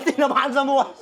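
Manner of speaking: quick words through laughter; laughing commentary, tickled; amused laughing. Real, not acted. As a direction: crack up at the mishap. Laughs at player hit in face.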